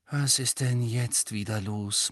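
slow, weary grumble; tired murmur. A narrator's reading. Harry turns to Angelina, tired and muttering. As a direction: mutter it, worn out. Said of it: "murmelte er matt".

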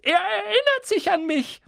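very high and loud, thrilled little man; begeisterter Dickel. Acted. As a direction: tell the whole pub, overjoyed. Dickel ruft in die Runde. (Dickel, sehr hoch und laut zur Runde)

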